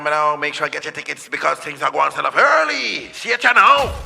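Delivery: hype DJ voice. Shown as a demonstration. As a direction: shout it like a hype DJ. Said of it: "it's a hype voice".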